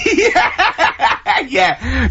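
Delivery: high, laughing yeah; laughing agreement. Real, not acted. Amused at joke about old people, laughing agreement. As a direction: agree through real laughter.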